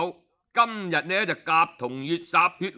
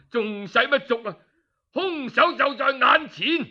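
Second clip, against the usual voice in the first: higher, indignant accusation; accusing outburst; 6 semitones higher than usual. Acted. The duke turns on the guilty minister, furious and accusing. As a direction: nail him as the murderer.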